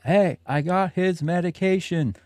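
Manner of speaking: high, slow call through the door; retold yelling. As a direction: stretch out each word as if hollering to someone inside. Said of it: "I'm yelling as loud".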